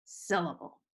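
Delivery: "syllable" with strong SYL, two weak syllables after; stress on first syllable. Shown as a demonstration. Teacher demonstrating a dactyl, first syllable of "syllable" stressed. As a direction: hit the start of "syllable", let the rest fall away. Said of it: "which is stressed, unstressed, unstressed".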